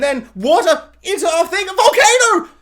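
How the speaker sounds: rising to a high, loud squeal with a laugh; hammed-up excitement peaking at volcano; mock excited pitch. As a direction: build up like an overeager writer. Mocking writers' over-the-top pitch.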